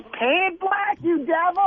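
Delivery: high, shrill-pitched imitation of a woman yelling; mock crazed concert lady. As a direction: ham up the tripping lady at the concert. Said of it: "Like the lady going".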